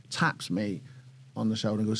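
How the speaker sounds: ordinary delivery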